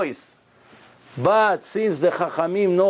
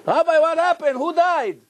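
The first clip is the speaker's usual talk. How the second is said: alarmed question; 11 semitones higher than usual. Acted. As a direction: cry out in alarm at a teacher on the floor. Student finds rabbi crying.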